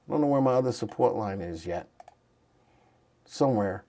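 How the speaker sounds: low, soft mutter to self; thinking aloud. Real, not acted. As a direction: trail off quietly while searching. Searching chart, talking to self.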